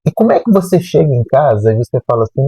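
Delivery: usual speaking voice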